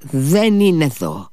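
a touch of Ivan's anger, held back; restrained angry denial. A narrator's reading. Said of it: "του φώναξε θυμωμένα ο Ιβάν".

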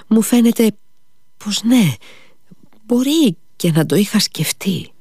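halting confession, pitch lifting on the uncertain bits; faltering. A narrator's reading. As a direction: grope for words with pauses. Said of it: "κομπιάζοντας".

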